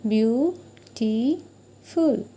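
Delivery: "beautiful" drawn out very slowly, each syllable separated; clapped-out syllables. Shown as a demonstration. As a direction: beat out "beautiful" one syllable at a time. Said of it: "if you pronounce the word beautiful".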